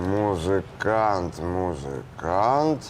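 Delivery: drawn-out, slow syllables; pondering a rhyme. Acted. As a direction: stretch the word while searching for a rhyme. Composing, searching for a rhyme.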